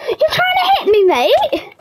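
very high, laughing taunt; laughing mockery. Laughing at Toby trying to hit him, high mock.

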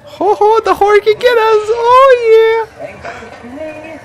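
hammy falsetto. Pretend. Hammed-up falsetto in game.

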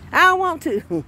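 high, whiny refusal; childish refusal. Acted. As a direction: sulk like a stubborn child. Believer refusing to love enemies.

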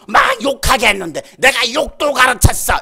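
loud, forceful demonic shout; boasting demon. Acted. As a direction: hammer out the boast at full volume. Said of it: "이러더라고".

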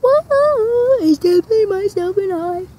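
high loud whoa; thrilled yell on a swing; excited shout. Real, not acted. Screaming while swinging.

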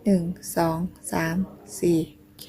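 ordinary delivery

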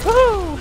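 short, high, loud woo; excited whoop. Real, not acted. Gamer whooping mid-match, high and loud.

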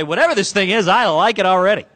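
like someone instantly sold on it; excited reaction. Pretend. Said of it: "you say".

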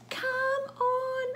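high, long-drawn call. Acted. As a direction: call out high, stretching each word. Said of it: "And then I say".

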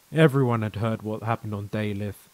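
ordinary delivery, nothing marked

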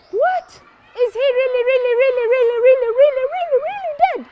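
high-pitched, rapidly repeated incredulous question; shocked disbelief. Acted. Crowd asking whether the giant is dead, shocked. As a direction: squeal it in comic shock, piling up the repeats. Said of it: "They are shocked".

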